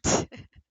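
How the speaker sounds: voiceless burst of breath; audible exhaled "hhh"; demonstrated H sound. Shown as a demonstration. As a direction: push out an "h" as pure air. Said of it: "It's just air coming out".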